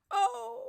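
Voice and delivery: sharp high yelp of sudden pain; pained cry. Retold or imitated. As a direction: cry out as the spasm hits. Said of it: "and I was like".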